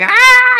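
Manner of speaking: piercing high panicked scream. Retold or imitated. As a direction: shriek out like the baby is coming now. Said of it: "So we were screaming".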